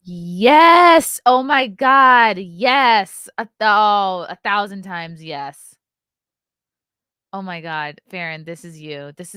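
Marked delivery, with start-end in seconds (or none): 0.1-5.7 loud, slow, overjoyed yes; thrilled. Real, not acted. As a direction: shout your delight at the perfect pick. Thrilled by casting photo.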